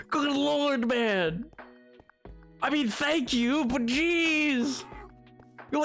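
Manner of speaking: ordinary talk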